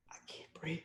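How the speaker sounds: breathless whisper of "breathe". Acted. Poet voicing Eric Garner's last words, whispered. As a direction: let the voice almost vanish. Repeated line fading to whisper.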